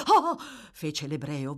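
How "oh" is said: sharp, high, loud "oh" of surprise; startled exclamation. A narrator's reading. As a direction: jump as if caught from behind. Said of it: "voltandosi di scatto".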